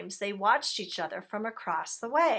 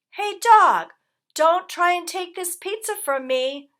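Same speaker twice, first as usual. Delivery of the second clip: loud, much higher call; 10 semitones higher, 10 dB louder than usual. A narrator's reading. Rat teasing dog about the pizza, loud. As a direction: call it out to tease him.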